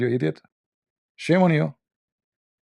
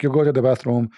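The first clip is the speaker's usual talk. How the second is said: ordinary talk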